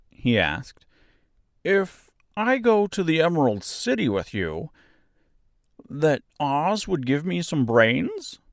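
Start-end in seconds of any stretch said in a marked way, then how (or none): 1.6-8.4 simple straw man longing for brains; good-natured scarecrow, hopeful. A narrator's reading. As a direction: plead gently in a high character voice. Voices the Scarecrow.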